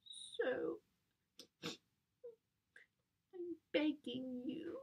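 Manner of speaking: soft, high, drawn-out plea; begging. Acted. Teen begging Dad to let her go, pleading. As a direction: beg slowly in a small high voice.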